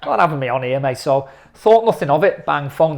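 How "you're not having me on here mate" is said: retold disbelief. Retelling disbelief at phone offer.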